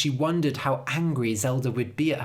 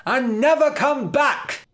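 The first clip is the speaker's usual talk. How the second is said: furious shout; 10 semitones higher, 6 dB louder than usual. A narrator's reading. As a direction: scream at the witch. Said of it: "she cried".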